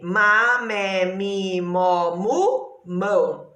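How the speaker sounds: chant-like classroom recitation, landing on "mão"; syllable family drill. Shown as a demonstration. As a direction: recite "má, mé, mi, mó, mu, mão" as even separate beats. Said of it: "família do M". (recitar "má, mé, mi, mó, mu, mão" como cartilha)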